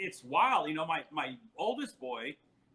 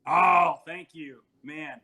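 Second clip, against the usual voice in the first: emphatic thanks; 2 semitones higher, 11 dB louder, slower than usual. Real, not acted. Thanked for congratulations.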